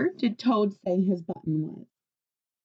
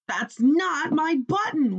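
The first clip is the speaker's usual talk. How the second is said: high, slow, louder wail; 7 semitones higher, 5 dB louder than usual. A narrator's reading. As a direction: Toad wails in despair, dragging it out. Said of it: "wailed Toad".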